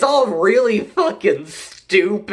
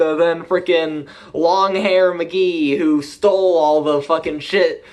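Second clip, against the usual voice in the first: normal talking voice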